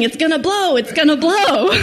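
high, urgent repeated warning; mock yell. Husband yelling as the engine won't shut off, high mock panic. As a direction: shout it over a revving engine. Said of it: "he's just yelling".

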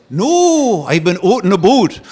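high, loud insistent Canadian "boat"; Canadian accent. Retold or imitated. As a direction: correct him in a thick Canadian accent. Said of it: "a thick Canadian accent".

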